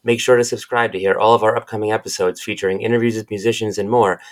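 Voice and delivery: loud, even ad read; podcast promo read. Acted. Host reading the podcast intro, a loud announcer read. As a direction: announce the show like a radio spot.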